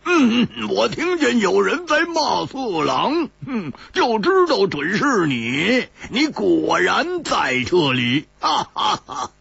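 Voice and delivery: amused teasing with a chuckle at the end; laughing friend. A narrator's reading. Hu Tiehua finding Chu Liuxiang, teasing and laughing. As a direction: tease him and laugh it off. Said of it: "有人笑着说".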